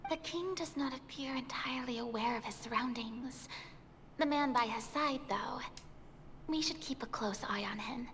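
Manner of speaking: soft unvoiced whisper. Real, not acted. Player warning the party about the minister, whispered.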